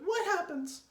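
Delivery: very high, loud squeal; frightened gasp. A narrator's reading. Wife asking husband what happens, high alarmed gasp. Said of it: "gasped Missus Twit".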